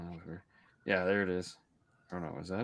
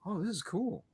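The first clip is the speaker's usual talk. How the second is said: gasp, then high, soft wonder; amazed four-year-old; 10 semitones higher, 7 dB quieter than usual. Retold or imitated. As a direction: imitate a little kid awed by a discovery. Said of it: "And he's like".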